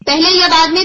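ordinary talk, nothing marked

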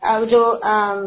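name each letter like a teacher; حروف کا نام واضح; letter names said slowly. Shown as a demonstration. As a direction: spell out the letters clearly. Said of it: "end of حروف تہجی میں آتا ہے نا".

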